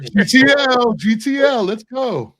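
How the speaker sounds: loud, high-pitched reality-show catchphrase; Jersey Shore shout. Acted. Quoting Jersey Shore to co-hosts, mock party shout. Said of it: "You want a Jersey accent?".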